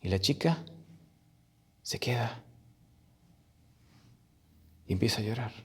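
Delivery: soft, near-whispered storytelling with long pauses; hushed narration. A narrator's reading. Storyteller describes the birthday girl starting to cry, hushed. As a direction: let the story drop to a hush. Party stops, girl begins crying.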